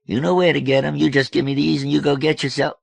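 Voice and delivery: loud, high, wheedling demand; pushy George. A narrator's reading. George begging the girl for beads, pushy.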